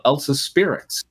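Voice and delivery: ordinary talk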